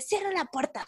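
high-pitched imitation of a scolding boss; stern order. Boss ordering an employee to shut the door, mock stern.

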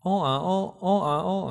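put-on high, patterned baby cooing cry; imitated hungry baby. Shown as a demonstration. Baby tells parents it wants to eat, imitated high cry. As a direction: sound out a baby's food signal. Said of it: "itu dengan nada yang berbeda".

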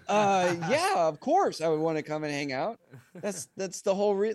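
high, loud, gushing yes; excited. Retold or imitated. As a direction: blurt it out overjoyed. Retells his thrilled reply.

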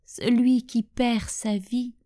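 measured, hushed poem reading with long pauses; poem recitation. Acted. Sharing a poem in homage to departed loved ones, measured recitation. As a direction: recite it line by line, letting each phrase settle.

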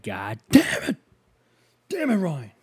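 mock an annoyed father cursing his kid; dad cursing. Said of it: "he'd be like".

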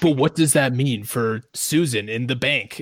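slow, ominous mock narrator; movie voice. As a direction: parody a horror-film narrator, drawing it out. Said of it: "perfect fucking movie voice".

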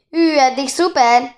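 high, loud, slow admiring call; drawn-out excited shout; shouted cheer. A narrator's reading. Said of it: "kiabálta Bori".

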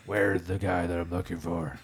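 throaty vigilante interrogation; put-on hoarse superhero voice; Batman. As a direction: growl it like the masked superhero. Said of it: "Do you always talk like that?".

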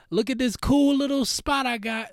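high-pitched show-off imitation; put-on gushing, high and excited; mock social-media bragging. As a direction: brag about it in a hyped-up mock voice. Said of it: "They're like".

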